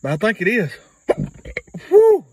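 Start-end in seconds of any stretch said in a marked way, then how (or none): 1.9-2.2 high loud whoop from the heat; burning mouth. Real, not acted. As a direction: gasp it out as the spice hits. Reacting to hot pepper bean.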